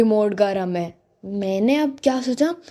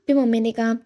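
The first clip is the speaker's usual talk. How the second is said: ordinary talk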